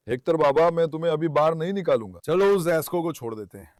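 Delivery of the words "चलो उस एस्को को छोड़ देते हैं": normal talking voice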